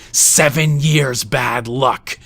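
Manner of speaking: high, loud, slow scream. Acted. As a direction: scream it in frantic pain. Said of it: "stabbing himself in the stomach and screaming".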